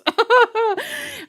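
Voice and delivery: bright high burst of laughter; high giggle after a joke. Real, not acted. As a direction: laugh at your own joke. Laughs at her own joke.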